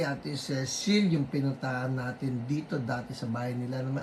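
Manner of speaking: usual speaking voice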